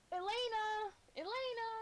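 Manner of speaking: high calling scream; woman screaming a name. Acted. Woman in the crowd screaming for Elena. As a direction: call out for a lost child. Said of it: "a woman screaming".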